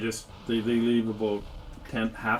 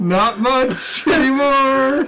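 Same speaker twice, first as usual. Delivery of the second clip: high, loud and drawn out; stretched, high-pitched triumphant yell; gleeful outcry; 9 dB louder, much slower than usual. Real, not acted. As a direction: crow it slowly over the uproar. Game master joins the amazed outburst.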